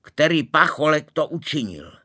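loud, high demanding shout; strong voice. A narrator's reading. Groom shouting at the wedding crowd, loud and high. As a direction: bellow at the whole church. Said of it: "pravil ženich silným hlasem".

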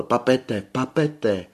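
loud, high desperate calling. A narrator's reading. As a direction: call out in despair from afar. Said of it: "jakýsi zoufalý hlas, jenž volal". (zoufalé volání)

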